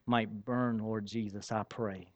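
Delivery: hushed, reverent closing prayer; quiet prayer. Real, not acted. Pastor closing his prayer before the sermon, hushed and reverent. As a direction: pray softly to end.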